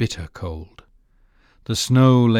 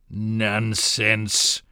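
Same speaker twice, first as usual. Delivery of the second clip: wolf growl. A narrator's reading. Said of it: "growled the wolf".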